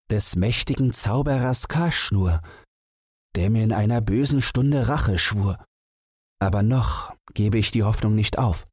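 a caliph urging his companion on; earnest caliph. A narrator's reading. Caliph speaks to vizier.

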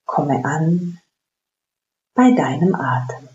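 slow, calm meditation voice; guided meditation. Acted. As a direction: guide them gently into stillness. Leads a guided breathing meditation.

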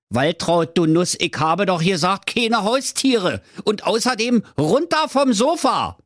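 high, scolding Berlin husband voice; grumpy Berlin husband. Acted. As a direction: nag her from the armchair. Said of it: "Der Witwer Werner sprach". (hoch gepresster, schimpfender Berliner Ehemann)

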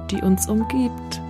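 ordinary delivery

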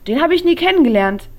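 put-on high, loud and fast girlfriend voice; perky friend voice. A narrator's reading. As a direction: play the cheeky friend, quick and bright. Friend Silly voiced in story. (übermütige Freundin, hoch, laut und schnell)

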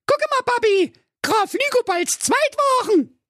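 put-on very high, girlish voice calling to her dad; excited princess. A narrator's reading. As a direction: squeal it to papa like a little girl. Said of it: "so rief sie".

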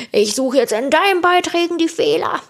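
high-pitched, teasing pretend threat; jokey high-voiced taunt; mock threat. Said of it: "Ich hab jetzt auch nicht gesagt".

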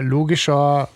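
normal talking voice

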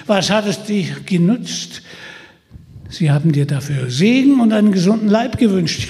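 lauter, höher spottender Versucher; verhöhn Peter mit schneidender Stimme; Holländer Michel, spöttisch. Acted. (Holländer Michel verspottet Peter, spöttisch und laut)

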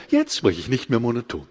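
low, mechanically repeated melody on "nicht mehr monoton"; forced non-monotone. Shown as a demonstration. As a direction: demonstrate trained intonation that stays monotonous on "monoton". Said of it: "nicht monoton zu sprechen". (aufgesetzte, gleichförmige Betonung auf "nicht mehr monoton")

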